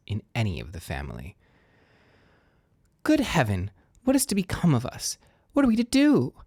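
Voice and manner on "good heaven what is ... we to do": rising, anguished wail of despair; bitter lament. A narrator's reading. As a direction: cry out in bitter woe, climbing higher. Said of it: "in the bitterness of woe".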